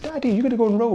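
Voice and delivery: put-on high-pitched little-kid question; imitated young daughter. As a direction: mimic a child asking Daddy. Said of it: "she said".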